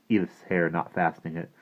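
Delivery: plain reading voice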